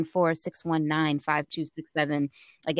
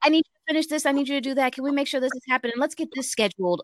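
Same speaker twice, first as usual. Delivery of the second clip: higher, quick bossy demands; mocking a boss before vacation; mimicking demanding boss; 6 semitones higher, faster than usual. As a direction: rattle off the orders like a manager. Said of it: "people keep piling on your plate".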